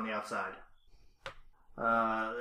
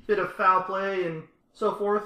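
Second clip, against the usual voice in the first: lightly raised, a hint of an official; NPC council member voice; 7 semitones higher, 4 dB louder than usual. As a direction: speak as a smooth town elder, slightly higher. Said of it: "he says".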